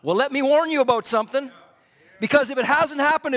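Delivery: normal talking voice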